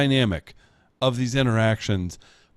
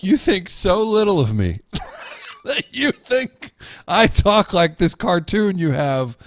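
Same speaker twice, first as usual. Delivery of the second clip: mock indignant; 6 semitones higher than usual. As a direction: pretend to be insulted to put them on. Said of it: "I'm like".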